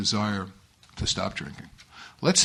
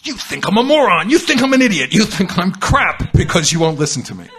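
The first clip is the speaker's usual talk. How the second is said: loud, high, exasperated yell; screaming at child; 5 semitones higher, 9 dB louder than usual. Retold or imitated. Father screaming at his four-year-old for not listening, exasperated yell. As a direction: yell it in outrage, each accusation pushed harder. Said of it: "Now I'm reduced to screaming at a four-year-old".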